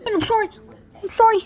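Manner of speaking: high, pleading little apology; squeaky apology. Pretend. Player apologizing to a partner, high squeaky plea.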